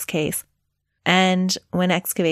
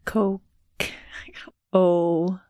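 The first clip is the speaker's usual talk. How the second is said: normal talking voice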